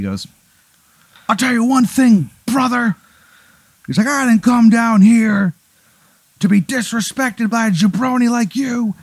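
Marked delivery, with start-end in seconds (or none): 1.3-3.0 high-pitched wrestling promo voice; the Menace's wrestler promo. Acted. Heel wrestler calls out a heckler, in promo voice. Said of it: "like an amalgamation of Ric Flair". 4.1-8.9 high, strained wrestling promo voice; the Menace's wrestler promo. Acted. Heel wrestler scolds a heckler in the crowd, in promo voice. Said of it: "like an amalgamation of Ric Flair".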